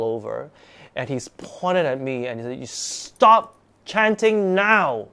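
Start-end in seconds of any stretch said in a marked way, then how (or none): 2.6-5.1 slow, high, loud shouted command; imitated scream. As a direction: scream the order, drawing out each word. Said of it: "He screamed at, at me".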